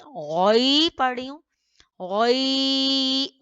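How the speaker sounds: गै sung out without the noon; isolated syllable demo. Shown as a demonstration. Teacher isolating the merged syllable for students, sung out. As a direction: hold गै long and high, no final n. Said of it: "गैन नहीं पढ़ी".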